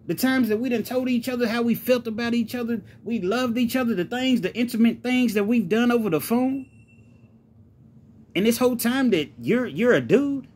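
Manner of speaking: incredulous outrage, high-pitched and insistent; indignant tirade, pitch climbing high on every phrase; furious. Acted. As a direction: demand answers in high, furious disbelief. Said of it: "Gene was furious".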